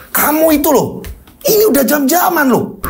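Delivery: exasperated complaint. Acted. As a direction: complain he's waited hours. Angry customer complains. (mengomel tidak sabar, nada tinggi)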